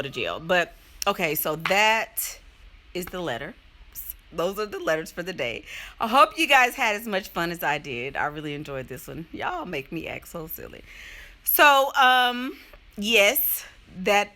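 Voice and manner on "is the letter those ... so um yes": usual speaking voice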